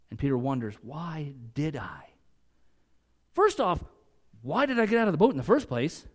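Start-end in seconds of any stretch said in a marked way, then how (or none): 0.8-2.1 a hushed whisper of the question; whispered memory. Acted. Peter replaying Jesus' question in his head, whispered.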